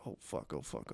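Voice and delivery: imagined nervous student whispering in dread at a placement; panicked whisper. Retold or imitated. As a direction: hiss it under your breath in terror. Imagining panicked rich kids.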